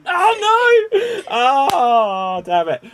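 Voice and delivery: high, loud wail of defeat; comic anguished groan into swearing; dismayed outcry. Real, not acted. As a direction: cry out at having just lost, then drop. Loses the timed challenge.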